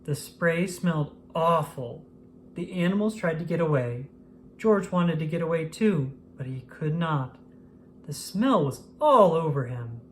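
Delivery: ordinary delivery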